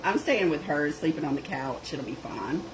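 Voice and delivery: ordinary talk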